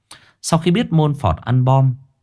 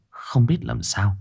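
usual speaking voice

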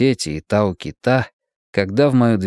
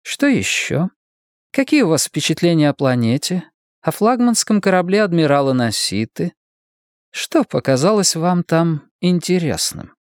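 higher-pitched woman in authority, asking; questioning woman leader; 8 semitones higher than usual. Acted. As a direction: press for a report in a raised register. Voices Gladstone questioning Severn. (расспрашивающая руководительница, высокий голос)

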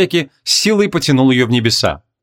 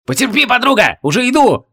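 distant call; 10 semitones higher than usual. A narrator's reading. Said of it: "послышалось откуда-то с другого конца".

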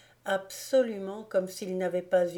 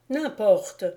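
a louder, forceful cutoff; sudden energy; 3 semitones higher, 7 dB louder than usual. A narrator's reading. Teacher ending the dispute, sudden louder outburst. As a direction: slam the matter shut. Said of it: "s'écria-t-il avec une soudaine énergie".

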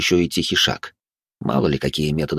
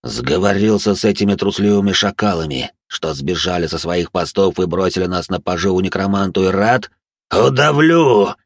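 otherworldly hiss. Acted. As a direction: hiss the threat like a phantom. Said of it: "прошипел фантом".

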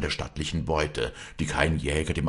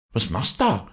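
higher-pitched puzzled question; astonished; 7 semitones higher than usual. A narrator's reading. Walli sees Josef loading his gun, mildly astonished. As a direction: ask with mild astonishment. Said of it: "fragte Walli erstaunt".

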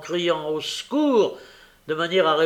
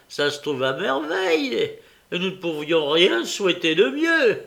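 high-pitched gleeful relish; gloating villain; 5 semitones higher, 5 dB louder than usual. A narrator's reading. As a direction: crow with delight, voice pitched up.

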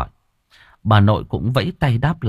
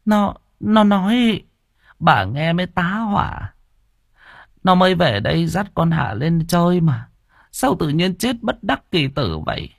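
put-on a higher, worried old woman's voice; a hint of a fretful grandmother; lightly voiced grandmother; 8 semitones higher than usual. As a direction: fret like a shocked grandma.

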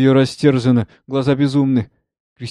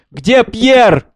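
high, loud, pressing question; insistent; 10 semitones higher, 10 dB louder than usual. A narrator's reading. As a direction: demand an answer, insistently. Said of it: "Настойчиво". (настойчиво, требовательно)

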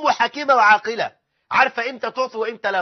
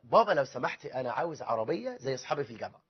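low, soft request to dad; lightly voiced meek asking; polite son; 7 semitones lower, 10 dB quieter than usual. Grown son asking father for a car, quietly polite.

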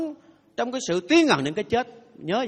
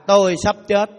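read again louder; 8 dB louder than usual. Shown as a demonstration. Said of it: "Đọc lại".

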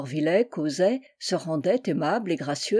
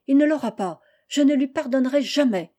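put-on higher, emphatic child's refusal; defiant refusal; 4 semitones higher, 4 dB louder than usual. A narrator's reading. Daughter refusing to forgive, defiant to her mother. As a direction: dig in, indignant and final.

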